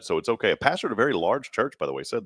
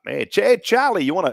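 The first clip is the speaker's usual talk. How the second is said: high-pitched mock Connecticut hail; put-on Connecticut accent, breaking off; 10 semitones higher, 4 dB louder than usual. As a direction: imitate a Connecticut pal calling out. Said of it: "I'd like to hear Okie say".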